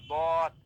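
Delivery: বদ said as a full, rounded "dod"; thick, drawn-out বদ with rounded o; thick, heavy letter. Shown as a demonstration. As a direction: make বদ heavy and loud. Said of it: "তখন মোটা করে পড়তে হয়".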